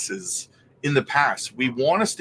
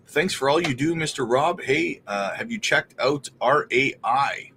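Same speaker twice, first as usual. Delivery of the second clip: ordinary delivery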